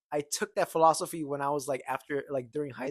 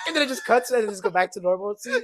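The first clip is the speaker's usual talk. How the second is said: ordinary talk, nothing marked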